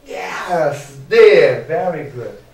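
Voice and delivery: hammed-up triumphant cheer; playful celebration. Teacher cheering to students for the correct answer, playful celebration. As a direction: burst out loud on the answer letter.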